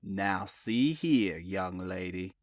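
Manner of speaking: low, slow Southern order, Now see here; ordering Tidewater drawl. Acted. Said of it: "Boone ordered".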